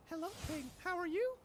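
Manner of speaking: high, sweet princess voice. Pretend. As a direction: chirp it like a Disney princess.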